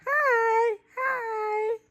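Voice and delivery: high, drawn-out, soft hi; high soft greeting. Acted. Grandma says what she says, high and soft. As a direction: sing out a small, light hi. Grandma answers with own sound.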